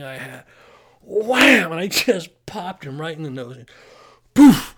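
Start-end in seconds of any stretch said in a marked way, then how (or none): 1.0-1.7 high, loud "Wham" like a comic book; loud cartoon punch noise; punch sound effect. 4.3-4.7 very high, very loud "poof" burst; loud cartoon poof; knockout sound effect.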